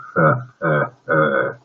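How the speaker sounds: low evil chuckle; a villain's gloating laugh. Acted. Villain laughs after threat.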